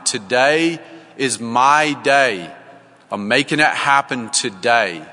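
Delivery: drawn-out rallying cry, half-shouted; retold yell. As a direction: proclaim it with determination, a restrained yell. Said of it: "yells up into the mountain".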